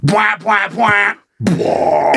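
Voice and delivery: mouth-made horn blasts; imitated club horn. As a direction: blare it out like a club air horn. Imitates club noise as fog starts.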